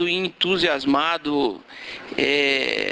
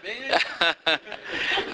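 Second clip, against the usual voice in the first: real amused chuckle; brief laugh breaking into the speech; short laugh; 6 semitones higher, 4 dB quieter than usual. Real, not acted. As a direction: chuckle before carrying on. Still amused by his joke.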